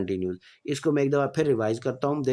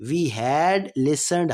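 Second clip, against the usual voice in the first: slow, clear dictation pace; slow classroom example sentence; slow example read; much slower than usual. Shown as a demonstration. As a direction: spell it out slowly for students. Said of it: "teacher reading tense example".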